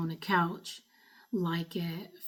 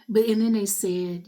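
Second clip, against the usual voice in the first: ordinary talk, nothing marked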